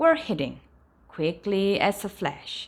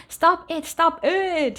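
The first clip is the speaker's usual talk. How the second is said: higher, louder pleading stop it; crying out; 9 semitones higher, 4 dB louder, slower than usual. A narrator's reading. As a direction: beg through the tickling laughter. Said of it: "he cried".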